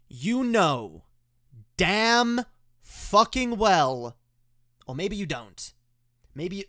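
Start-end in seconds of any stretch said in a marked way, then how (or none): 0.1-4.2 loud, high angry rant, slow on key words; so sick of this argument; furious rant. Real, not acted. Host rants at right-wing critics, a loud furious rant. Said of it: "I'm gonna address this right off the bat".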